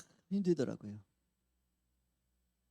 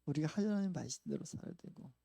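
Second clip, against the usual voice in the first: ordinary delivery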